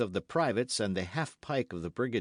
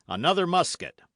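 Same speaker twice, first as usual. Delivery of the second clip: command; 7 semitones higher, 7 dB louder than usual. A narrator's reading. Calls for musket in battle.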